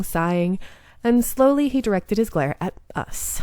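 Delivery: ordinary delivery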